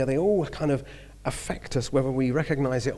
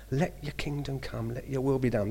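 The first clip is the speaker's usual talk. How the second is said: reverent quote; 6 dB quieter than usual. Acted. Quoting the Lord's Prayer.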